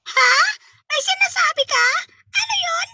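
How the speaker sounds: very high, squeezed questioning; pinched squeaky voice. Pretend. Host asking Boji what he said, pinched squeaky voice. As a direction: ask it in a tiny squeaky pitch. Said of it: "Ipit na rin yung boses ko".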